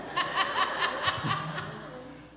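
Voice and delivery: long high airy laugh. Real, not acted. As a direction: burst out laughing. Laughs at kids' shout.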